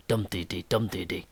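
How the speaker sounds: "Dum" hit hard, "dee-dee" light and quick; dactyl rhythm. Shown as a demonstration. Teacher sounding out the dactyl beat for students. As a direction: tap out "Dum-dee-dee" with one strong beat. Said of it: "That meter with one stress".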